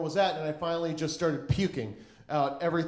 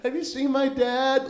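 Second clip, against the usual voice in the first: put-on high-pitched helpless child asking around; mock little-kid plea; lost kid; 9 semitones higher, 4 dB louder than usual. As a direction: plead like a child lost in a store. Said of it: "felt like a fucking shitty lost kid".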